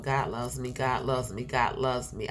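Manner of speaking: ordinary talk, nothing marked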